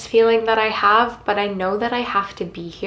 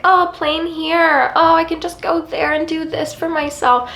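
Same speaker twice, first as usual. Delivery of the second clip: spoiled brat; 7 semitones higher than usual. Retold or imitated. Mocking herself as a spoiled brat, in a high airy voice. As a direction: whine it like a pampered rich girl. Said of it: "I feel like a spoiled brat talking about this".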